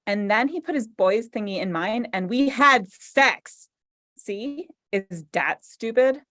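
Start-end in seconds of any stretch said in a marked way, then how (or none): none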